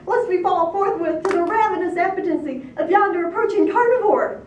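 high, loud frantic pleading; near hysteria. A narrator's reading. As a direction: implore at the top of the voice in panic. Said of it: "they implored on the verge of hysteria".

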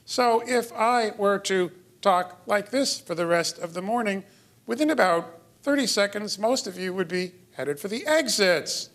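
high-pitched, strange sing-song melody; odd inflection. Shown as a demonstration. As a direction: demonstrate unnatural prosody, held up high. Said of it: "And then odd inflection".

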